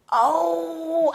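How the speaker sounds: a high, loud, tearful "Oh" in a retold panic; retold crying gasp. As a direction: gasp it out, about to cry. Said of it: "I'm crying".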